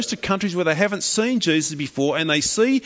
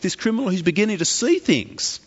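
normal talking voice

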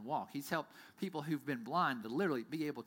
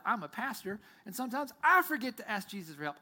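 ordinary talk

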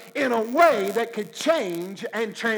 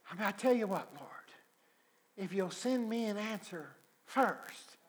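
hushed bargaining; 9 dB quieter than usual. Acted. Believer bargains with God.

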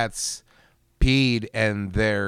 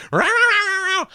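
rapid mock cat yowling; imitated cat scream; 6 dB louder, much faster than usual. Owner imitating the squashed cat under the cushion, mock screaming. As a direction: screech like a startled cat. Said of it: "as he scream".

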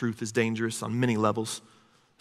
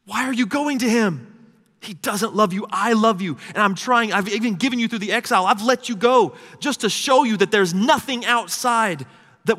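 high, forceful voice of a pleading God; impassioned pleading; 8 semitones higher, 5 dB louder than usual. Retold or imitated. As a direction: plead like a husband begging his wife back. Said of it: "You hear God pleading".

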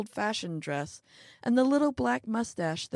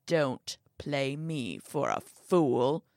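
put-on old man's voice; 4 semitones lower, slower than usual. Old man rebukes little man.

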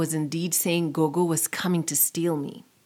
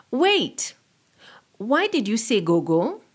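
surprised; 4 semitones higher than usual. A narrator's reading. Child's surprised thought.

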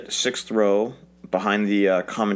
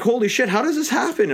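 surprised; 13 semitones higher than usual. Retold or imitated. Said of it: "It's like".

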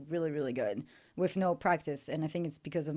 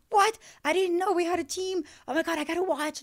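much higher, louder excited what; mock astonishment; 11 semitones higher, 3 dB louder than usual. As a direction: play the amazed fan. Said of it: "And like".

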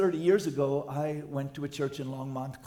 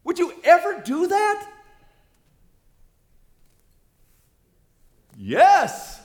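much louder, high preaching cry; preaching at full force; 14 semitones higher, 14 dB louder, slower than usual. Acted. Pastor confronting the church about gossip, loud preaching. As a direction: hammer the question and answer.

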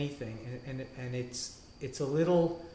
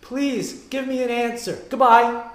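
higher, louder hammed-up caller; mock phone prayer; 9 semitones higher, 11 dB louder than usual. Praying person phoning God with a problem, hammed-up loud voice. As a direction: play an eager caller dialing up God.

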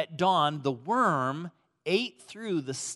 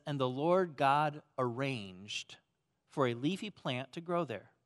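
scripture reading; 4 semitones lower, 5 dB quieter than usual. Acted.

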